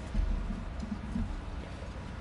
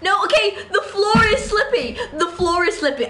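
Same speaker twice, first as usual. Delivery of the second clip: laughing panic; 8 semitones higher than usual. Real, not acted. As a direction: squeal it with a laugh. Sliding on floor in game.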